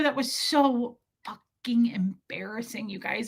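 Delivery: slow embarrassed whisper. Real, not acted. Confessing to viewers how embarrassing it was, whispering. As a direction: hush it like a confession.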